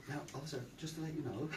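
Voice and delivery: soft, deferential heads-up; super polite. Retold or imitated. Monk warning officer about his bead bag, super polite. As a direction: break it gently and politely. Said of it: "You start to be this super polite person".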